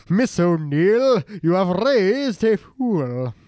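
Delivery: milk every word for mock drama; slow, hammed-up grand pronouncement; very dramatic. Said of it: "sounds like it'd be very dramatic".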